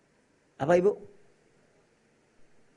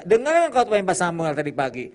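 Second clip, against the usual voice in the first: husband scolding; 4 semitones higher, 9 dB louder than usual. Retold or imitated. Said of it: "Suami berkata".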